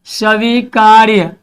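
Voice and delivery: "स्वीकार्य" stretched syllable by syllable; very slow, deliberate "स्वीकार्य"; slow dictation. Shown as a demonstration. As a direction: draw out "स्वीकार्य" for students to write. Meaning repeated slowly for students.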